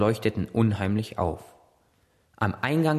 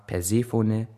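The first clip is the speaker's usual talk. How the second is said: plain reading voice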